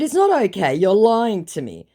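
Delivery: indignant accusation. Retold or imitated. Retelling her accusing chat reply.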